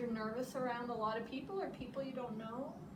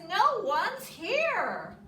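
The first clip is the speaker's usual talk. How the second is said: loud, slow exclaim; crying out; 7 semitones higher, 8 dB louder, much slower than usual. Acted. Bear calling out that the tree is empty. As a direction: call out in dismay, drawn out. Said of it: "Bear cries".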